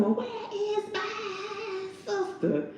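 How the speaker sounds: very high, slow whiny wail; mock crying high plea; Leonardo DiCaprio impression. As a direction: stretch it out high and slow like a spoiled man. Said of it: "it was the way he said it".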